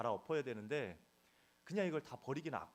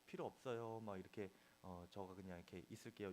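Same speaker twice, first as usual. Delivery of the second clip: timid reply; 3 semitones lower, 7 dB quieter than usual. Retold or imitated. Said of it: "이렇게 말을 하다가는".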